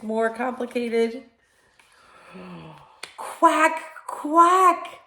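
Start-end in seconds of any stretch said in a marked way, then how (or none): 3.2-5.0 high, loud quacking; duck quack. A narrator's reading. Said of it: "called the duck".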